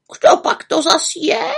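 a curious talking raven; raven asking. Acted. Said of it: "ptal se Abraxas".

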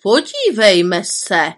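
lightly voiced spiteful aunt; haughty mean aunt. A narrator's reading. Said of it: "Byla nafoukaná a zlá".

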